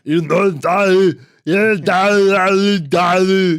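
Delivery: mock Andre the Giant slurring "Dolly"; imitating Andre the Giant. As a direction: mumble "Dolly" like the giant who can't say the name. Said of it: "He sounds like".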